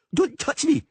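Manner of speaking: put-on girl yelling at the Witcher, high and loud; yelled by girl. A narrator's reading. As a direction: scream it at him. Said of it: "she yelled".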